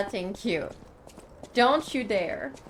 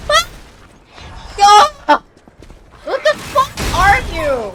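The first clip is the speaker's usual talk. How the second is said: loud, higher cry of disbelief; alarmed shout; 9 semitones higher, 10 dB louder than usual. Real, not acted. Player confronting a frightening creature, shouting. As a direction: shout it at the monster in shock.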